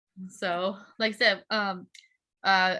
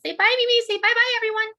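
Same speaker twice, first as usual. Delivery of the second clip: much higher coaxing voice for a little one; 14 semitones higher, 6 dB louder, faster than usual. Real, not acted. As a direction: coax Mimi to say bye to everyone. Coaxing little Mimi to wave.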